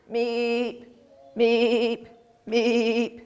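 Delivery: small squeaky meeps; meeping frog. Shown as a demonstration. A teacher imitating meeping tree frogs. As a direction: meep it like a tiny tree frog. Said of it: "tree frogs meep".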